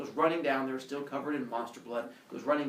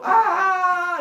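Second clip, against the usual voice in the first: high, loud, long scream; horrified scream; 14 dB louder than usual. Retold or imitated. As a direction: scream like terrified villagers. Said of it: "they go".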